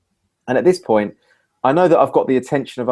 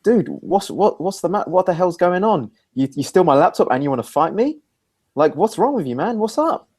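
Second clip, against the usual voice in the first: baffled confrontation; 7 semitones higher than usual. Retold or imitated. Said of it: "I just kind of went".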